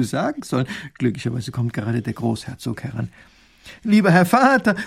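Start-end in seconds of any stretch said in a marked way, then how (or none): 3.8-4.8 higher, louder call. Acted. Princess calls out to her father as she runs to him. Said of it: "ruft die Prinzessin".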